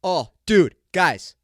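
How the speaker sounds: ordinary talk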